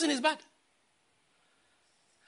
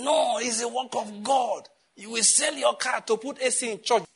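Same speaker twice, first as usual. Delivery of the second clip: high, drawn-out, declaiming protest; insistent church member; 6 semitones higher, slower than usual. Acted. Member insisting to pastor it's God's work, high drawn-out protest. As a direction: stretch it out like a zealous objector.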